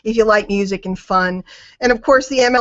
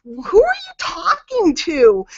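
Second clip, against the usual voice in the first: incredulous; 6 semitones higher, slower than usual. Retold or imitated. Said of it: "I'm like".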